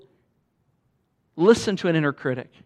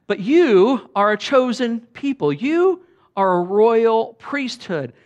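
louder, higher proclamation, slow and punched; sparring declaration; 6 semitones higher, 7 dB louder, slower than usual. Shown as a demonstration. Pastor declaring scripture over the congregation, loud and slow. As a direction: fire it back at the critic, slow and loud. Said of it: "like you're sparring".

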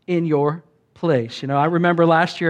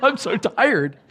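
higher-pitched comic whine; exaggerated tiredness; 6 semitones higher, slower than usual. Napped halfway through workday, comically exhausted. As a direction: moan it in a mock-weary high voice.

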